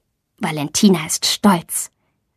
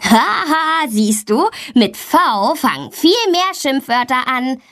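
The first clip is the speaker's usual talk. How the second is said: higher-pitched mocking "haha" taunt; gloating; 5 semitones higher than usual. A narrator's reading. Brother laughing at his little sister's few insults, gloating. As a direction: crow over the victim with glee. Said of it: "lacht Leon dann schadenfroh".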